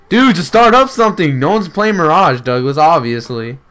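annoyed shout. Real, not acted. Loud annoyed at friend.

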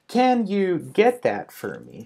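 careful slow teaching pace; slow model sentence. Shown as a demonstration. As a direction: spell it out word by word for learners. Model sentence said slowly, then naturally.

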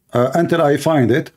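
ordinary talk, nothing marked

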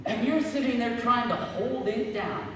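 normal talking voice

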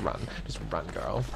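whispered urging to herself. Real, not acted. Player whispering to her character while hiding. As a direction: hush it, egging yourself on.

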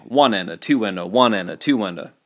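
"one" and "two" leaned on, "and a" light; stress on one and two. Shown as a demonstration. Teacher shows students the natural accent in the count. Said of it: "when you count it like this".

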